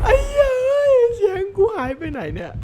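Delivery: very high, thin cracked wail; voice giving out. Real, not acted. Gamer cursing as the voice disappears, cracked wail. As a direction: squeal it until the voice fails.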